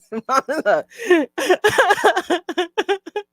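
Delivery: drawn-out high laugh; cackling laughter; long rising laugh. Real, not acted. Keeps laughing.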